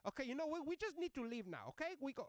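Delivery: put-on high, loud accented order; impatient lady passenger. Passenger ordering driver to leave, loud impatience. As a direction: cut off the discussion and demand departure.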